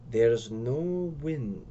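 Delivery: very slow, grave and ominous. Acted. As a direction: say it like a dire warning. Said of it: "Ricardo says gravely".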